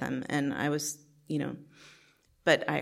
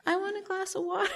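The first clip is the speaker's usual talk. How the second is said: put-on much higher, small child asking; little kid voice. Toddler siblings asking big sister, high little-kid voice. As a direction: pitch it way up like a toddler pleading.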